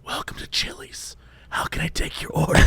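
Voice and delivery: breathy whispered waitress greeting; whispered asmr role play. Retold or imitated. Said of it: "now it's like".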